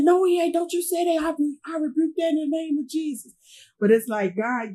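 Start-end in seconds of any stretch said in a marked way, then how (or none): 0.0-3.3 a high, fierce echo of grandma's refusal; grandma's denial. Retold or imitated. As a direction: push back hard, pitched up.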